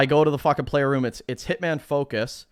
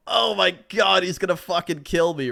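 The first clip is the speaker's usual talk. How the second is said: higher-pitched, hammed-up dread; mock panic; 6 semitones higher than usual. Fan dreading Happy's reaction, mock panic. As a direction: play up comic terror. Retold fear of streamer's cold reply.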